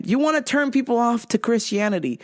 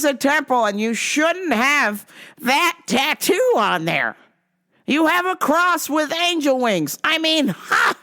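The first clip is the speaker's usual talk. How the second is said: higher, slow sermonizing stranger; preachy Rome guy; 5 semitones higher, slower than usual. Retold or imitated. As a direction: lecture her like a zealot. Preachy man lectures on tattoo.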